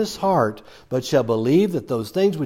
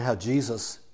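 normal talking voice

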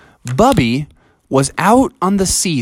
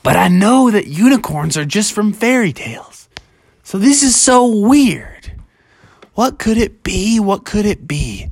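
lightly acted wonder, pitched up; a touch of higher-voiced bafflement; puzzled Bubby; 5 semitones higher than usual. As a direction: think aloud as a mystified story character. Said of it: "And he said to himself".

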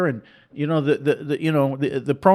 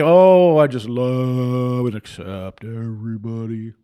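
drawn-out lower mock of someone who loves everybody; mocking sanctimonious voice; 4 semitones lower, slower than usual. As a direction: parody a smug tolerant believer. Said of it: "And you can say".

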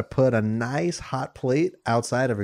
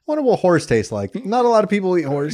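higher, faster goofy horse voice; 6 semitones higher, faster than usual. Pretend. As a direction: voice the horse's thoughts. Horse's imagined thoughts voiced.

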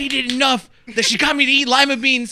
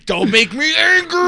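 slow, higher mock-Hulk threat; hammed-up angry warning; 7 semitones higher, 4 dB louder, slower than usual. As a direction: draw out a hammy warning, pitched up. Acts out a Hulk-style catchphrase.

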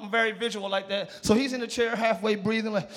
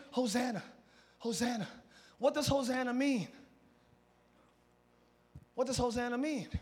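much softer, reflective; quiet reflection; 6 dB quieter than usual. Real, not acted. Preacher pondering what Hosanna means, quiet reflection. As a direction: say it softly, almost to yourself. Drops to quiet after screamed take.

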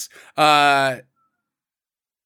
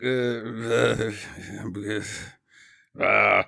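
that guy's grunts; 8 semitones lower, 5 dB quieter, much slower than usual. Retold or imitated. Imitating the doctor running around, grunted impression. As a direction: lower put-on grunting. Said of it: "that guy impression".

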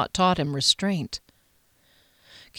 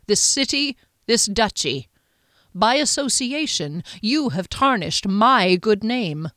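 stern Duke, condemning; 3 semitones higher than usual. Acted. The Duke to his captain, grave condemnation.

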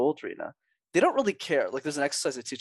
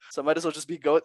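usual speaking voice

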